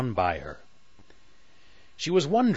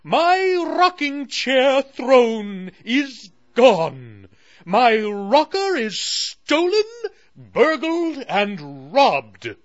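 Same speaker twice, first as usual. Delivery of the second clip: high, loud, slow indignant royal voice; a queen stamping her foot over a stolen throne; huffy Moon Queen; 13 semitones higher, 9 dB louder, slower than usual. Acted. Narrator voicing the queen at her lost throne, high and huffy. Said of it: "she huffed".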